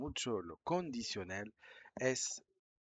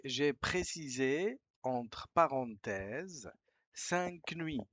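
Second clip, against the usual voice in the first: slow, careful reading; 3 semitones higher, 3 dB louder, much slower than usual. Shown as a demonstration. Teacher reading the email line slowly to learners. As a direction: read each word slowly, clearly.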